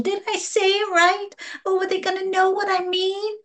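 high-pitched fretful questioning; mock worrier, voice pitched right up; anxious self-doubt. Practitioner voicing a client's needless worry, high and anxious. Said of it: "You don't have to question".

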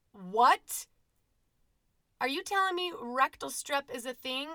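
loud, high disbelieving what; incredulous shout. Retold or imitated. Mère incrédule au cabinet.

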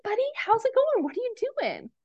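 put-on high, light greeting to a child; gentle mom voice. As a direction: warmly check in with your little boy. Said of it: "turns everything off for a second".

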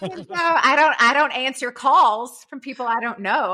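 amused laughing aside. Real, not acted. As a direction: giggle it out. Laughing while telling the story.

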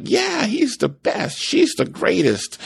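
high, slow mock praise; gushing partner. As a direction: ham up a fan's bragging a little. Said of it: "And so they are like".

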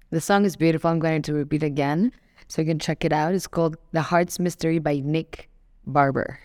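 ordinary talk, nothing marked

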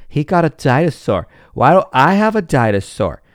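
mock child demanding a dinosaur; whiny jealous kid. Child demanding the dinosaur his brother got, whiny.

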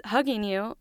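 ordinary delivery

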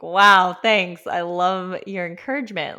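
loud mock-grateful opener, then easing off; heavy sarcasm; sarcastic thanks. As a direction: lay on the fake gratitude. Sarcastic reply to passerby.

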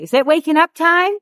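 high-pitched imitation of a little kid; mimicking a small child's question; three-year-old child. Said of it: "I have a three-year-old".

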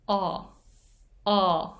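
"O" held open and steady, mouth not moving; demo vowel of ball. Shown as a demonstration. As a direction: say "O" as an open aw with no glide. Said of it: "投げる方のボールの母音". (「O」を口を動かさず開いたまま)

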